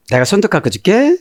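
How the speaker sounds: put-on high, sweet childish voice; childlike voice. Daughter to her dad, a high childlike offer. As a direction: play the little daughter, sweetly.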